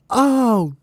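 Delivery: very high, drawn-out falsetto wail; squealed disgust. Acted. Woken birds smell the fart in the nest, squealing in disgust. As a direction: squeal in horror at a stink.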